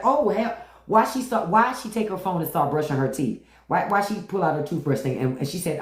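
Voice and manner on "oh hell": higher, startled gasp of disgust; shocked recoil. Retold or imitated. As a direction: recoil in mock horror at the teeth. Girlfriend recoils from the teeth.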